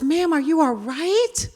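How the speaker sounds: lightly voiced caring neighbor; concerned man. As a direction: voice the stranger asking what's wrong. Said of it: "He goes".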